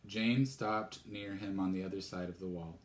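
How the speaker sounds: plain reading voice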